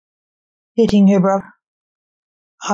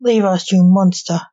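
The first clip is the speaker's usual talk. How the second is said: plain reading voice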